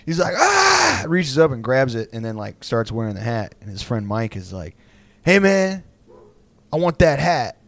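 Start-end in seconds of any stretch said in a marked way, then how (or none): 0.3-1.0 high strained "Ah!"; leaping grab cry, pitched way up; effortful yell. Retold or imitated. Acting dad's leap for hat. 5.2-7.6 raised-pitch "hey, man" demand; mock calling out. Imitating friend calling for hat.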